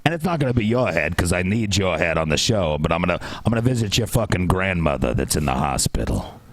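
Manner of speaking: low mobster making threats; mafia voice. Acted. Warning debaters not to break the rule, mafia-boss menace. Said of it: "you gotta use your mafia voice".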